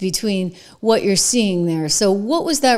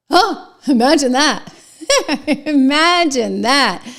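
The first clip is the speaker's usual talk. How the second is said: slow, loud, higher mock gasp with a laugh; mock shock, laughing at it; sarcastic; 5 semitones higher, 5 dB louder, slower than usual. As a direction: drip with sarcasm over her five nights of work. Sarcastic jab at anchor's workload.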